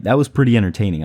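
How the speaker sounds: usual speaking voice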